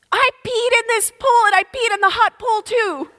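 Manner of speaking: put-on high, childlike triumphant boast; a little girl scaring off the boys; proud toddler voice. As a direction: announce it proudly to the whole pool.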